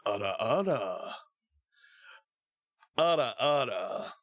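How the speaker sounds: low, slow sultry Russian ara ara; teasing Russian ara ara for a viewer; Russian-accented ara ara. Pretend. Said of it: "I'll do it in Russian just for you".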